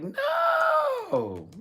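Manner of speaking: mock shrieked refusal; high emphatic no. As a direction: shout a high, loud no.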